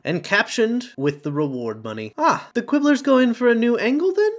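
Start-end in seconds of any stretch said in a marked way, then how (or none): 2.2-4.3 cold question. A narrator's reading. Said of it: "Harry asked coldly".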